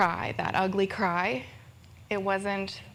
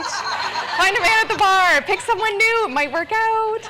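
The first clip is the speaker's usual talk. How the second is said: squeaky high laughing mockery of the advice; 13 semitones higher, 9 dB louder than usual. Mocking bad divorce advice, high and laughing.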